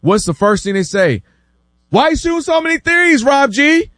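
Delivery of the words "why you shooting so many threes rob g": high-pitched complaining heckle; mock indignant critics. Fans turning on a young star they once loved, mock indignation. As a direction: imitate fed-up fans griping, pitched way up.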